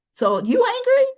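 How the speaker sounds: acted nosy challenge; pestering question. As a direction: needle them about their mood.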